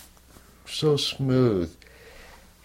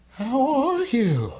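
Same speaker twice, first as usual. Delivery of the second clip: high, slow and tender, like a kind teacher; gentle guru's question; 13 semitones higher, much slower than usual. Retold or imitated. Guru quoted asking gently.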